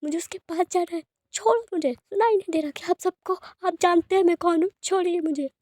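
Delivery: high, pleading cry to be let go; frantic high-pitched pleading; desperate plea. Acted. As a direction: struggle and beg to be released. Patient's relative held back by doctors.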